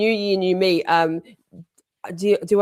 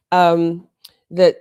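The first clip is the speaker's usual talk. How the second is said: ordinary talk, nothing marked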